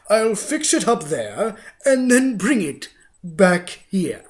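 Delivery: a villain faking kindness; fake sweet Grinch. A narrator's reading. The Grinch lies to the little girl, loud and sugary. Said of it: "the fake Grinchy Claus lied".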